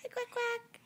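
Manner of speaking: put-on high, sing-song prompt for a toddler; high, coaxing "Hi, Quack Quack". Shown as a demonstration. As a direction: model the words brightly for a baby to copy.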